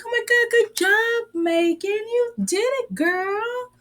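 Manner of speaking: high, cheering mock praise; imitating an over-the-top friend praising Megan. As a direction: cheer her on like a proud friend. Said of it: "And then she's like".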